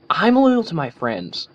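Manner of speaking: slow, loud, high, hammering each word; emphatic Rainbow Dash. A narrator's reading. Rainbow insists to Twilight where her loyalty lies, slow and loud. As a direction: drive the point home word by word. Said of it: "emphasizing the word with a jab".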